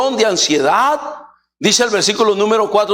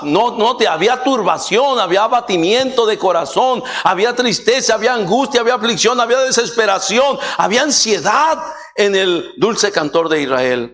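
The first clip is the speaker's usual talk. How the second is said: raised, driving sermon voice, pitch higher; fiery preaching; 4 semitones higher than usual. Acted. Preacher at full force. (predicación enérgica, tono más agudo)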